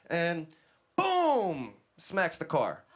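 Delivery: loud, high-pitched boom; crash imitation. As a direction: punch out the impact of a crash.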